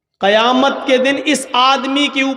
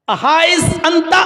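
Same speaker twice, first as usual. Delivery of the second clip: shouted call; 7 semitones higher than usual. Retold or imitated. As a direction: shout after him. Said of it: "آواز دی".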